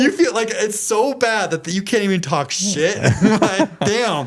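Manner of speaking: amused at how pitiful it got; laughing. Real, not acted. Telling his co-host about the group chat, laughing.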